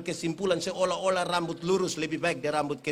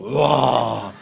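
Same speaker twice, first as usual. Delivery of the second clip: low loud crying wail; mock child wail; 8 semitones lower, 10 dB louder than usual. Scared boy crying out at the bogeyman, loud mock wail.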